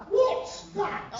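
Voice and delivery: puzzled royal, high and prim; Queen impression.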